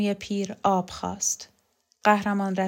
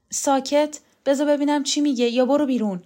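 higher, urgent command to be silent; sharp order; 6 semitones higher, 5 dB louder than usual. A narrator's reading. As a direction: order them to shut up, fast and impatient. Orders a sobbing woman silent.

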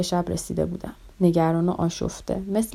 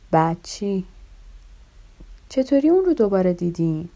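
ordinary talk

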